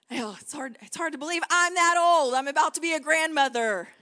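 usual speaking voice

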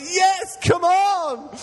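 very high, loud shout of victory; triumphant shout. Retold or imitated. Preacher reliving his celebration, shouting very high and loud. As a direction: yell it like a goal was scored.

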